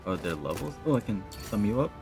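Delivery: normal talking voice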